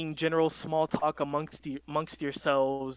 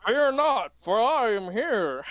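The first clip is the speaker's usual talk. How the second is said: loud, high heroic proclamation; pompous vain hero; 6 semitones higher, 6 dB louder, slower than usual. Acted. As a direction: declare it like a grand hero. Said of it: "That's so dumb".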